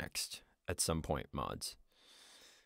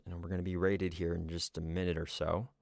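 normal talking voice

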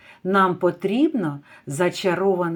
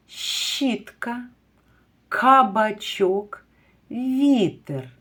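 кабачок drawn out slowly; slow example word for children; slow careful word; 3 semitones higher, much slower than usual. Shown as a demonstration. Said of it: "ви слухайте".

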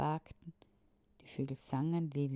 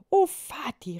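high, louder joyful cry; excited children; 10 semitones higher, 13 dB louder than usual. A narrator's reading. The twins cry out to their father in joy. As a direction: cry out in delight. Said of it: "rief Julius und Juliane".

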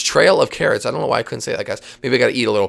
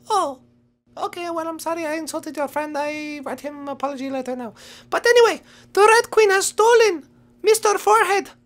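apologetic rabbit. Pretend. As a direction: apologise in a small high voice, then announce the news. Rabbit apologises, then resumes news.

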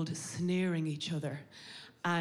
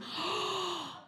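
high-pitched theatrical gasp; shocked gasp; 11 semitones higher than usual. Acted. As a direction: gasp in mock horror. Acted shocked listener to the story.

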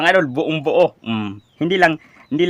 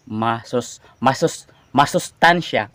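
sounds out "masustansya" slowly again; slow careful saying; 2 semitones lower, slower than usual. Shown as a demonstration. Vlogger slowly sounds out the hard word. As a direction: ease into "masustansya" syllable by syllable. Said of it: "Unti-unti lang nating bigkasin".

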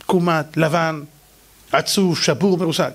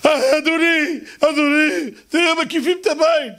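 high panicked calling; alarm, the house is surrounded; panicked cry; 9 semitones higher, slower than usual. Acted. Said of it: "הנער של אלישע צועק".

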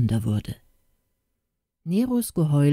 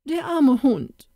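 high, slow pitying lament; wailing in shock; 9 semitones higher than usual. A narrator's reading. Pastor's wife seeing her hurt dog, slow shocked lament. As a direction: moan it, shocked and full of pity. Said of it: "jammerte die Pfarrerin erschrocken".

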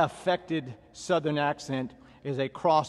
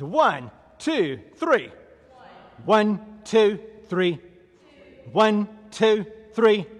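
raised, high steady count; drill cadence; 4 semitones higher, 4 dB louder, much slower than usual. Real, not acted. Trainer counting stationary lunges for class, called cadence. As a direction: call each number out in even rhythm.

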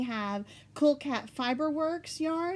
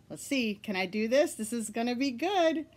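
normal talking voice

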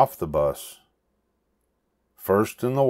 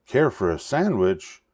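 eager thought; 7 semitones higher than usual. A narrator's reading. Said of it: "he thought".

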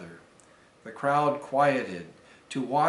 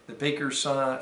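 ordinary delivery, nothing marked

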